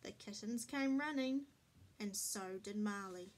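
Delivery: plain reading voice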